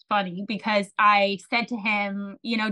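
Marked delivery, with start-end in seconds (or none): none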